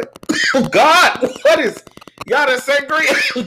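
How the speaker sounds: coughing through the words; words broken by coughing; choking. Real, not acted. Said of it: "going down the wrong pipe".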